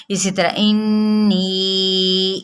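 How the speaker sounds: انی slow with ghunna on the noon; Quranic recitation. Shown as a demonstration. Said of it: "غنہ کرتے ہوئے". (غنہ کے ساتھ آہستہ)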